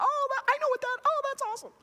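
high, gushing moviegoer voice; mock delighted viewer. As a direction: play a fan recognizing the reference. Audience reaction voiced.

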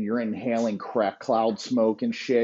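ordinary talk

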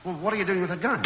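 ordinary talk, nothing marked

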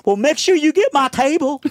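high, loud mock yell; yelling waitress. Waitress hollering to secure returning customers, high yell. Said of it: "she yells in my ear".

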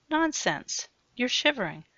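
high, bright nonsense!; insistent fussing. Acted. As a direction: fuss over him eagerly. Sue insists, fussing over him.

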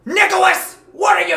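high, loud shocked mom; mom snapping. Retold or imitated. Mom catching her son flopping on the couch, high sharp call. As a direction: catch him mid-fall and call him out. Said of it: "And she's like".

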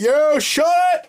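high, loud street holler; imitated catcall scream. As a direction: mimic men yelling after a passing woman.

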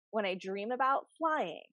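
ordinary delivery

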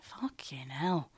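slow, breathless unvoiced whisper. Stunned whisper after the boyfriend's confession. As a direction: mouth it in shock under your breath. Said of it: "whispered aside".

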